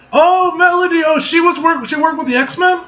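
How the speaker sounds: very high, loud delighted outburst; excited recognition. Real, not acted. Reader spotting Melody among X-Men, excited and high. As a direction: let the surprise leap up high.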